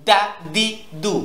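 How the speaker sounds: "দা দি দু" short and sharp, each separate; "দা দি দু" with no lengthened vowels; sharp short syllables. Shown as a demonstration. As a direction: say "দা", "দি", "দু" as crisp bursts. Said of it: "উচ্চারণও যেন তীক্ষ্ণ হয়".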